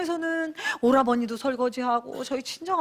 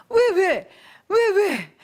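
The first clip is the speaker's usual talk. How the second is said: 억울한 외침; 7 semitones higher, 5 dB louder, much slower than usual. Retold or imitated. 억울함에 반복 외침.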